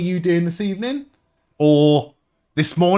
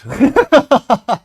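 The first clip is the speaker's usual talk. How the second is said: loud high burst of laughter; big laugh; 9 semitones higher, 7 dB louder than usual. Real, not acted. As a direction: crack up at the joke. Laughs at old Twitch name.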